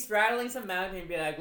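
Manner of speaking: ordinary talk, nothing marked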